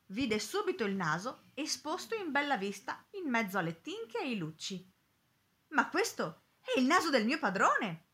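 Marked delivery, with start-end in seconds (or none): 5.7-8.0 higher-pitched shocked outburst; horrified exclamation. A narrator's reading. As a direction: exclaim in mild horror at a discovery. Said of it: "esclamò inorridita".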